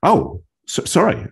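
flustered retold reaction; taken aback. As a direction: re-enact being knocked off balance. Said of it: "I almost fall off my chair".

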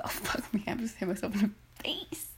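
soft, voiceless self-mockery; muttered aside. Real, not acted. Catching her own slip, whispered to herself. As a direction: laugh it off under the breath.

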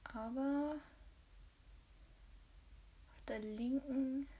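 soft unvoiced whisper; leaning in to whisper. Real, not acted. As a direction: whisper it close to the mic. Aside while painting nails.

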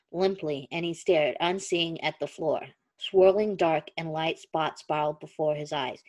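low, steady storytelling voice; story narration. A narrator's reading. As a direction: read it like an audiobook. Reads the story aloud.

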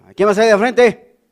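a mock stern teacher; teacher calling out. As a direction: summon the pupil to the front. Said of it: "Así llegaba mi profesor".

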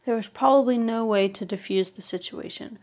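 plain reading voice

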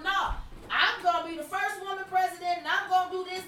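hammed-up confident politician; mock Hillary boast. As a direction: play her as sure she's won. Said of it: "You thought".

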